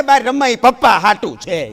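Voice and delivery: put-on a small kid yelling with glee; excited kid shout. Youngest kid shouting answer. (નાના છોકરાની ઉત્સાહી બૂમ)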